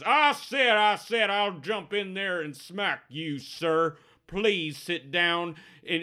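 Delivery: put-on high, blustering old regular; old-timer breaking up a fight at length; old barfly scolding. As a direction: start loud, then ease into slow courtly rebuke. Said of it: "the old guy at the bar says".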